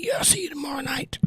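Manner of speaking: soft whispered goodbye to viewers. Real, not acted. Whispering goodnight to her live stream family. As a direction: breathe it out to the chat. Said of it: "whispered sign-off".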